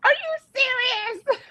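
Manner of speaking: very high, slow exasperated squeal; mock outrage, voice way up; fussing. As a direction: scold him in playful disbelief. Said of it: "'cause I'm fussing".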